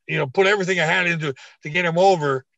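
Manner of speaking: ordinary delivery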